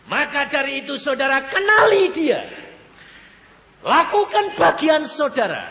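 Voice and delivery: high, loud pulpit urging; forceful exhortation. Real, not acted. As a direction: press the congregation hard. Preacher urging the congregation.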